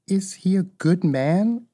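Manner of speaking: put-on a touch of a worried little girl; mild anxiety. A narrator's reading. Dorothy asking about the wizard, mildly anxious. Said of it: "inquired the girl anxiously".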